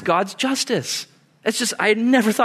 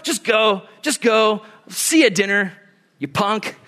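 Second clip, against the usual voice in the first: slightly raised, impatient parent; mock curt dismissal; 5 semitones higher than usual. Punitive parent dismissing the child, mildly mock impatient. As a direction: wave the kid away, acting fed up.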